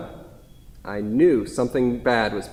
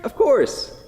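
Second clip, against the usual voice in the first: overly bright; 12 semitones higher, 3 dB louder than usual. Acted. Paul overeager to waitress.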